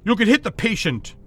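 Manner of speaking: loud, angry holler. Acted. Doctor hollering at the security guard, loud and angry. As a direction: yell at the guard to hold fire.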